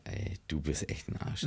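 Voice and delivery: slow, breathy whispered insult. Real, not acted. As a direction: hush it out, slowly, half laughing. Mock insult after penis mask joke.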